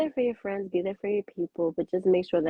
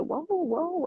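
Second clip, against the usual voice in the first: alarmed whoa; 9 semitones higher, 4 dB quieter than usual. Retold or imitated. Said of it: "I was like".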